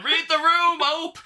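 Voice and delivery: squeaky high mock scream; high shrieking friend. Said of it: "While she's being thrown in the van".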